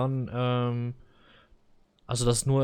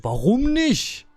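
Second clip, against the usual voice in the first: higher, louder demanding question; retold outburst; 11 semitones higher, 7 dB louder, slower than usual. Customer retelling snapping at the clerk, loud and higher. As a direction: fire it back at her in disbelief. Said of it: "Ich bin komplett ausgeflippt".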